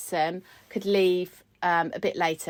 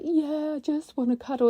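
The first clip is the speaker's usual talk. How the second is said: put-on soft, higher, childlike yes; little-girl reply; 7 semitones higher, 3 dB quieter than usual. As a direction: answer like a comforted child. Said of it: "I'm like".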